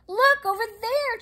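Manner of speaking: high, fast call to the friends; cried out. A narrator's reading. Chinchilla crying out to distract his friends. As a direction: point and cry out, high and quick. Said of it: "Chandler cried out".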